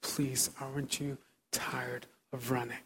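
slow, soft pleading; soft earnest plea. Real, not acted. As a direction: beg them gently to get up. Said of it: "I plead".

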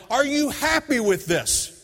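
high, slow, bitter yelled question; complaining yell. Retold or imitated. Father complaining to God in the yard, high and slow. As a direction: throw it skyward in frustration. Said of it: "I'm complaining".

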